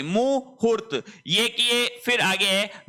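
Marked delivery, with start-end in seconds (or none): 0.0-1.0 मुहूर्त slowly and high, "mu-hoort"; short then long. Shown as a demonstration. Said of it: "ये की ये स्थिति मुहूर्त में रहेगी". (मुहूर्त धीरे, छोटा मु, लंबा हू)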